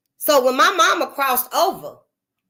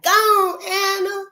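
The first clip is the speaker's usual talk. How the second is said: much higher called-out farewell; auntie's cry; 10 semitones higher, much slower than usual. Retold or imitated. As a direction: call it up to the sky. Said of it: "my auntie was like".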